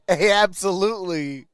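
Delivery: ordinary delivery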